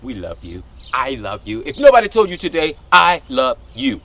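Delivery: normal talking voice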